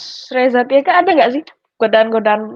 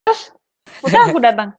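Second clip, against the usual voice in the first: ordinary talk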